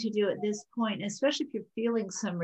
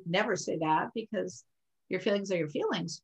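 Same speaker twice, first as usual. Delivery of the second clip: usual speaking voice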